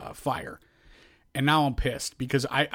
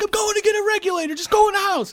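frustrated yell; 5 dB louder than usual. Retold or imitated. Angry retort to mom retold.

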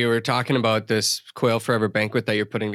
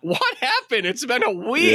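mock disbelief; 10 semitones higher than usual. Asking a returning buddy what happened, in high mock disbelief. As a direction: act floored by the change. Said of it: "And I'm like".

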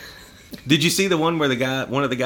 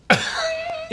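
high laugh at your own line; a sharp, high cackle; burst of laughter. Real, not acted. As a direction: crack up.

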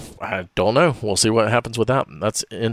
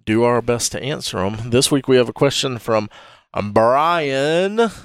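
announcer; 5 semitones higher than usual. Acted. Mailbag segment intro.

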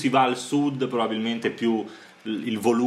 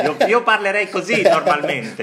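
much higher, louder squeaky cartoon voice; high ringing Mario voice; 10 semitones higher, 7 dB louder than usual. Shown as a demonstration. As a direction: pitch way up like Mario. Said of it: "anche io una voce squillante, tipo Mario".

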